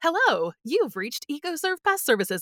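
high, bright recorded greeting voice; phone greeting voice. Retold or imitated. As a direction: sound like a business voicemail message. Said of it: "it was like".

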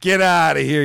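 bartender-style holler; acted angry yell. Joke teller ending the bear joke with a mock angry yell.

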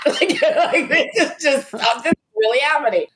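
high, laughing amazement; laughing disbelief. Real, not acted. Excited retelling.

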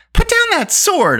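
put-on high indignant cry from a girl; high-pitched, affronted order; indignant squeal. A narrator's reading. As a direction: squeal it, indignant, drawing the words out. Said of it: "a squeal of indignation".

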